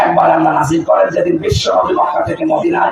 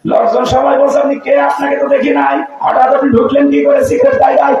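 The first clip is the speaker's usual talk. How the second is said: high, urgent preacher's narration; impassioned sermon storytelling; 10 semitones higher than usual. Real, not acted. Preacher voicing a gathering challenging a stranger, impassioned storytelling. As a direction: carry the crowd's challenge high and insistent.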